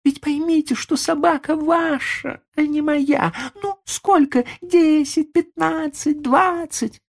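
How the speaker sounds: very high, haggling lady's voice; insistent rich lady. A narrator's reading. Lady bargaining with the old man for his dog, insistent. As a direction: press the offer in a high-pitched lady's voice.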